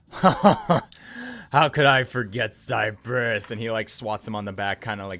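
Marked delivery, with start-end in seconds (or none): 0.1-3.5 loud, slow, hearty roar; booming joviality. Acted. Goliath back-slaps an old acquaintance, booming. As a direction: stretch it out big and hearty.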